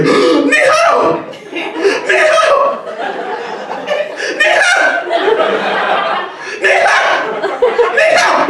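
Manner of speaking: shrill falsetto imitation of a thrilled stranger; imitating excited lady. Chinese lady thrilled by his greeting, mock high squeals.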